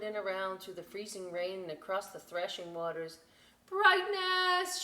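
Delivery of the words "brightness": high, loud call into the storm; desperate call into the wind. A narrator's reading. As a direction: call her name across the waves. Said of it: "she called".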